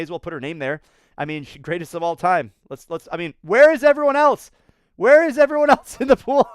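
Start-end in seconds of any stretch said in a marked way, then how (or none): 3.4-4.5 high, loud disbelieving where; incredulous shout. Real, not acted. To viewers, amazed no one else is in the pool, shouting. Shocked no rivals in view. 5.0-6.5 high, loud, laughing through the question; laughing disbelief. Real, not acted. To viewers, laughing that the pool looks empty, loud and high.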